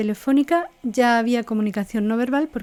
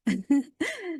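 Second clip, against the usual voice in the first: 1 light laugh; 6 semitones higher, 4 dB quieter than usual. Shown as a demonstration. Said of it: "cómo lo digo".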